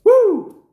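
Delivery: high, loud "woo"; long high-pitched cheer; excited whoop. Real, not acted. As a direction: let out a big celebratory whoop. Hyping the countdown between entries.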